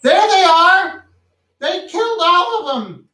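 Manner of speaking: high, shrill-pitched panicked accusation; shrieking woman. Acted. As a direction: screech it out, high and frantic.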